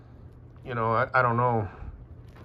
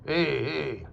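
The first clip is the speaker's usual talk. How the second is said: Boston accent; 4 semitones higher, slower than usual. Shown as a demonstration. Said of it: "Boston is a little bit more".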